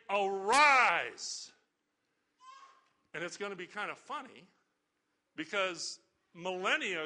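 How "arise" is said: loud ringing command, held out; commanding call. Retold or imitated. Preacher voicing Jesus to the dead, loud command.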